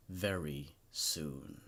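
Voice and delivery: whispered-down ending; ominous fade. A narrator's reading. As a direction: let the last words sink away into dread. Ominous last line of story.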